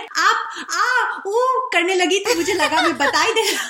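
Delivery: high, fast, giggling Hindi line; playful retold Hindi confession, quick and laughing; mock sheepish confession. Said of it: "he says it in Hindi".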